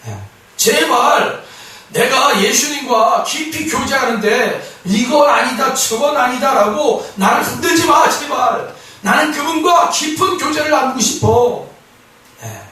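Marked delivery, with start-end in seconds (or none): 0.6-11.7 a raised, desperate please; pleading. Acted. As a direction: beg them to leave you alone. Believer pleading don't disturb.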